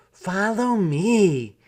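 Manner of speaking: lightly voiced city mouse, eager. A narrator's reading. City Mouse leading her cousin.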